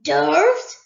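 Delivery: loud, rising mock surprise; pretended surprise. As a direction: fake being startled by the word. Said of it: "in pretended surprise".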